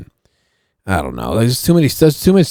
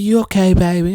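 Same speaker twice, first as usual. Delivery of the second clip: high-pitched neighbor impression; Rochelle; 9 semitones higher than usual. Neighbor checking on him in the car, high imitation. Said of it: "Rochelle talks like that".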